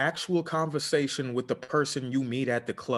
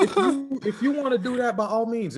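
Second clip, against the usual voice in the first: high, loud rant; 10 semitones higher, 3 dB louder than usual. Real, not acted. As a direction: heat it up into a rant. Ranting at lottery players.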